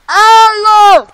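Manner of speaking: long, high, very loud holler; calling out. Acted. Lost survival host calling for help, loud holler. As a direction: holler it far across the land.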